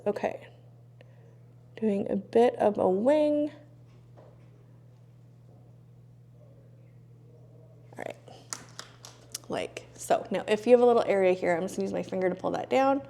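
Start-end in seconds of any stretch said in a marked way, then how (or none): 0.1-10.2 strained breath-held near whisper; holding breath. Real, not acted. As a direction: speak while holding breath. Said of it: "I always hold my breath for this".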